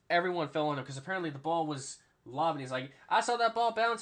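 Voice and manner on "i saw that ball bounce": high, loud player impression; excited retelling. Player recalling the bouncing ball, high loud excited impression. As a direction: imitate a worked-up player.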